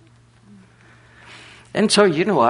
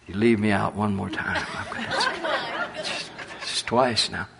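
laughing; 5 dB quieter than usual. Retold or imitated. Joking complaint to God laughing.

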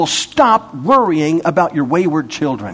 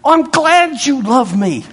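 naive believer; 5 semitones higher, slower than usual. Acted. Believer, higher voice.

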